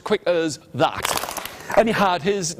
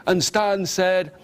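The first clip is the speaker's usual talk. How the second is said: ordinary delivery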